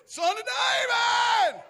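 very high, loud, drawn-out shout; crying out. Retold or imitated. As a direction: call it across a crowd. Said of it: "he began to cry out".

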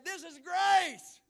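slow, loud, drawn-out preaching shout; preached shout. Acted. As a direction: boom it out slowly, full force. Jesus's words preached loud.

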